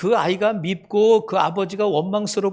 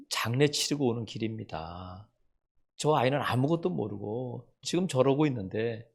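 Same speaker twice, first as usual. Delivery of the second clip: a broken father quietly apologizing to strangers; hushed grieving apology; 9 semitones lower, 8 dB quieter than usual. Retold or imitated. Father apologizing to angry passengers on the subway, hushed and grieving.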